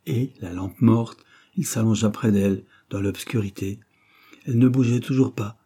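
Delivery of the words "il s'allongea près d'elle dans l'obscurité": usual speaking voice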